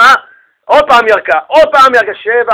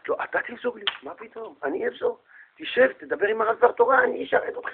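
very soft, half-whispered courtesy; hushed, exaggeratedly considerate; put-on politeness; 6 semitones higher, 12 dB quieter than usual. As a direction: fuss quietly over each other in front of a guest. Couple fakes politeness before guest.

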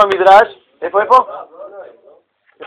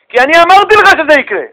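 God's voice pitched higher and louder, scolding; exasperated God; 9 semitones higher, 4 dB louder than usual. Acted. God scolds Moses, indignant and raised. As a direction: push it up, indignant, as the Creator scolding.

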